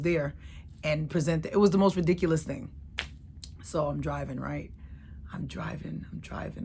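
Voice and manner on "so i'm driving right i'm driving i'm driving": fading out. Real, not acted. Repeated driving, fading.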